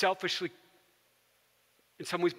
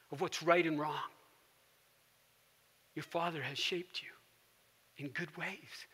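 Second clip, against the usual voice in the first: hushed gentle counsel; 2 semitones lower, 6 dB quieter than usual. Retold or imitated. Mister Rogers counsels the journalist.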